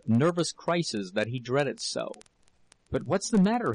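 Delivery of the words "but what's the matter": higher, urgent cry; worried cry. A narrator's reading. Husband cries out to sobbing wife, higher. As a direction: press her for an answer, alarmed. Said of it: "he cried".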